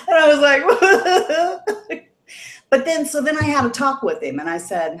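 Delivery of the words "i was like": high, slow laughing; real giggling disbelief. Real, not acted. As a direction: laugh through the words. Reacting with laughter to comments.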